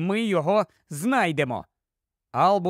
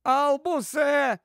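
high, drawn-out call; calling for help; 8 semitones higher, slower than usual. Acted. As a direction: call his name from inside the bookcase. Calls from inside the bookcase.

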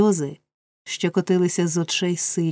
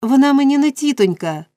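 put-on raised, louder boyish protest; crying out; 7 semitones higher, 8 dB louder than usual. A narrator's reading. Oliver objects to calling her auntie, crying out. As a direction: cry it out, high and loud. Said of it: "скрикнув Олівер".

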